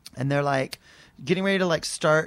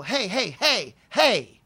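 retold calling out; 4 semitones higher, 4 dB louder, much slower than usual. Interrupting the fighting woman.

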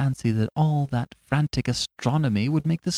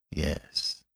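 breathy low unvoiced yes, much softer; low whisper; 7 semitones lower, 10 dB quieter than usual. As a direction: breathe the word almost unvoiced. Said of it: "in the same low voice".

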